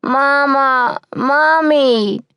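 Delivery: high, loud crying scream; screaming for mama. A narrator's reading. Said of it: "screamed Molly again".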